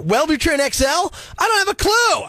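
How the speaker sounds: mock outrage at a vague drug name; exasperated outburst. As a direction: throw up your hands in disbelief. Ranting about drug ads.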